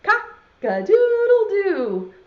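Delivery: loud, high, drawn-out crow; rooster crow. Acted. Teacher leading children in the rooster's call, crowing. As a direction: crow it slowly and loudly like a rooster. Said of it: "then everyone has to say".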